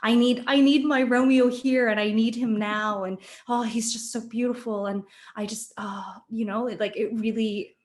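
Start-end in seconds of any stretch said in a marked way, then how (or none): 0.0-4.8 louder, rushed and higher, longing for Romeo; breathless yearning rush; eager Juliet. Retold or imitated. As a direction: gush like a girl who can't wait. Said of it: "how quickly she's like".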